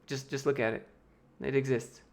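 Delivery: ordinary delivery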